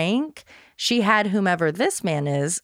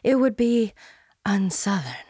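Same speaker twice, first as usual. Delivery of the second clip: Southern belle voice. Retold or imitated. Blanche pumping herself up, in a light Southern belle accent. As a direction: tease the word unsouthern like a Southern belle. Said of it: "in her best Southern belle voice".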